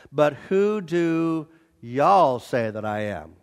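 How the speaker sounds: slow southern drawl on "y'all". Shown as a demonstration. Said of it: "the literal translation of verse fifteen says".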